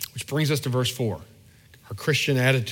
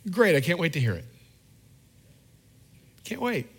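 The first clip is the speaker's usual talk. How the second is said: sincere eagerness; 4 semitones higher than usual. Retold or imitated. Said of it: "here's the attitude of my heart".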